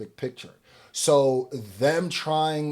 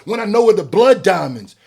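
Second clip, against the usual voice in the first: impassioned rant; 7 semitones higher, 5 dB louder than usual. Real, not acted. Ranting about blood diamonds.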